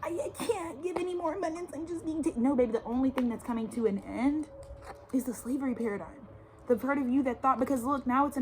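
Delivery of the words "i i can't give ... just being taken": mock whiny complaint. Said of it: "they're still like".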